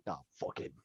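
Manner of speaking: low, very soft dwarf grumble; Bragg giving up. Acted. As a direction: mutter it deep and defeated. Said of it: "Bragg is just giving up and is like".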